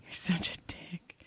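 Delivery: a breathy whispered jab, half joking; whispered insult. Real, not acted. Co-host teasing partner on podcast, whispered jab. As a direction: hiss it at your co-host, teasing.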